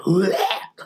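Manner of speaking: imitated metal growl. Shown as a demonstration. Showing viewers the growling she doesn't mean, mock growl. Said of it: "like, the growling or, like, the".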